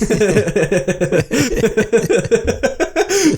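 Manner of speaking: a long, high burst of laughter. Real, not acted. Laughing at own joke.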